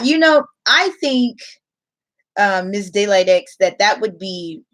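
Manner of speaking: ordinary delivery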